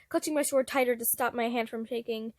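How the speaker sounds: usual speaking voice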